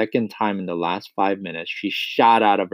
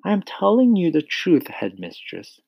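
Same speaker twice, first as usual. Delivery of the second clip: high-pitched teacher voice, dropping at the end; Miss Honey insisting; 7 semitones higher than usual. A narrator's reading. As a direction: insist earnestly to the headmistress. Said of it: "she said".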